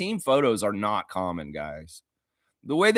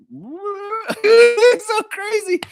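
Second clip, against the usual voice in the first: very slow, much higher, louder put-on voice; imitating Jacoby; 8 dB louder, much slower than usual. Mocking Jacoby's weird photo face, slow goofy high voice. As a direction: stretch it out in a goofy dazed voice. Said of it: "He was like".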